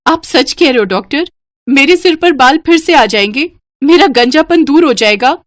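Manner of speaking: can it really be true, ask breathlessly; excited hopeful sister asking the doctor; hopeful excitement. Acted. Rakhi asks doctor hopefully.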